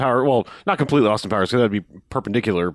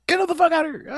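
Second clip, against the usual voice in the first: shrill panicked shriek, far higher; freak out; faster than usual. Retold or imitated. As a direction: squeal it in total panic. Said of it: "immediate freak out mode".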